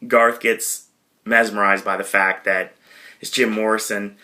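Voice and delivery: usual speaking voice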